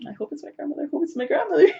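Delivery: high, hurried mock pleading; anxious wishing. Recalling her wish at the Ouija board, high and anxious. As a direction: quote a nervous wish in a small high voice.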